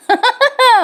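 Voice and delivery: high burst of real laughter; a sudden high laugh. Real, not acted. Narrator laughs again.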